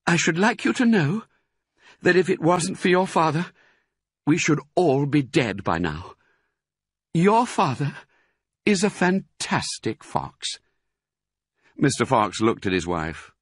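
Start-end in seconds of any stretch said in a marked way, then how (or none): 0.1-10.6 high-pitched, admiring mother; proud Missus Fox. A narrator's reading. Mother praising father to her children, proud. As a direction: declare it fondly, pitched high. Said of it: "Missus Fox said to her children".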